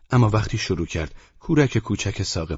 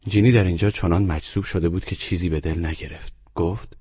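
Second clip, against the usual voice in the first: ordinary delivery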